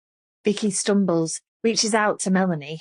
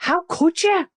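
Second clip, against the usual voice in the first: screamed, much higher and louder; 12 semitones higher, 4 dB louder than usual. A narrator's reading. Melanie at Vicky, screaming in fury. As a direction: scream it at her. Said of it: "she screams".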